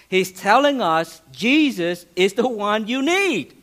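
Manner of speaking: normal talking voice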